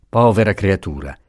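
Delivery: usual speaking voice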